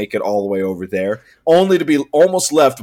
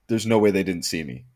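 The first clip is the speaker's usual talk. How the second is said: low, softened, quicker aside to oneself; muted disbelief; 6 semitones lower, 8 dB quieter, faster than usual. Retold or imitated. Friend recalling being passed by the car, muted disbelief. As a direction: say it under your breath in disbelief. Stunned self-talk while left behind.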